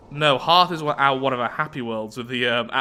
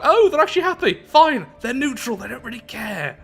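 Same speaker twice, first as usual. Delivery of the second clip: high-pitched burst of surprise, starting loud; surprised outburst; 11 semitones higher, 6 dB louder than usual. Real, not acted. Player finds the grumbling faction actually happy, surprised. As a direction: exclaim it high, easing off in volume by the end.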